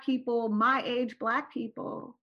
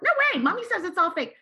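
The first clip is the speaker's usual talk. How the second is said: put-on child insisting; 5 semitones higher, faster than usual. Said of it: "I'm like".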